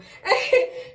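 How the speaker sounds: a high burst of laughter; delighted laugh; bright laugh. Real, not acted. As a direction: laugh out, pleased. Laughs after girl quote.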